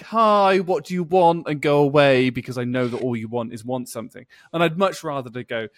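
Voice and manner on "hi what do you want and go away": annoyed retort. Retold or imitated. Own annoyed reply to boss.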